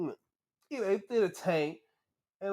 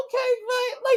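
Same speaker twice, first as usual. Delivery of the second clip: impressed approval; 5 dB louder than usual. Retold or imitated. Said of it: "I'm like".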